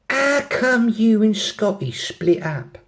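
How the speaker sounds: put-on high, drawn-out London accent. London girl asking about her friend's break-up, London accent. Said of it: "have a go at the accents".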